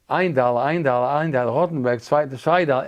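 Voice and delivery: rhythmic, insistent bid-calling; Yiddish auction caller. Retold or imitated. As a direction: call out bids like an auctioneer in Yiddish. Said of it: "there was a bidding process".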